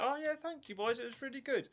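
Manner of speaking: high, sweet grateful customer; high old lady voice. Pretend. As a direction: chirp it like a thankful old lady. Mock customer praise in video.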